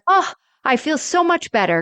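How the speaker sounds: lightly voiced cheerful Rose; quoting Rose, relieved. Host retelling Rose cheering up, lightly voiced. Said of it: "she's like".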